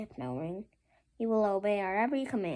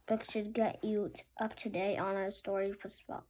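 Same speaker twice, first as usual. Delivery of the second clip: usual speaking voice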